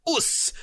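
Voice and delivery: high, soft "us" meaning nailed it; Aussie "us". Shown as a demonstration. Explaining Bandit's catchphrase, high soft "us". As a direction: clip "us" short and proud. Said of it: "And it's".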